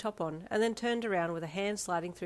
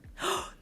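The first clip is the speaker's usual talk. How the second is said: gasp; 13 semitones higher than usual. A narrator's reading. Thrilled gasp.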